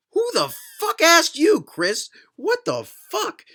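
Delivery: high, loud, exasperated yell; outraged outburst. Acted. Reader interrupting Chris's paragraph, outraged yelling. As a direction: screech it at the absent author.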